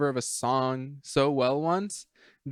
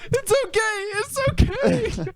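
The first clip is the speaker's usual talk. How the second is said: much higher, slow mocking squeak; mock squeaky voice; slower than usual. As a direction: parrot it back in a high squeak. Mocking friend's line.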